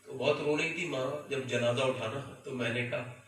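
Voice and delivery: ordinary talk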